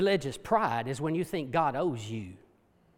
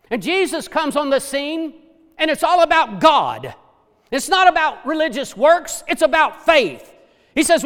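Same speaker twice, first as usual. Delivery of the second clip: full-force preaching, louder; 8 semitones higher, 8 dB louder than usual. Acted. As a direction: preach it out to the congregation.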